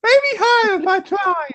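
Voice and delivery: strained falsetto; high-pitched test voice. Shown as a demonstration. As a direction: squeak it out at the top of the range. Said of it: "then try higher".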